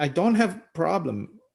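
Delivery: usual speaking voice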